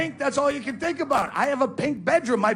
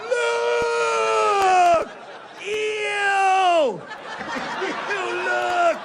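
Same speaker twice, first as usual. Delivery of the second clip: shouting; 9 semitones higher, much slower than usual. Retold or imitated. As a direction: yell it down an empty road, grossed out. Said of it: "shouting down an empty highway".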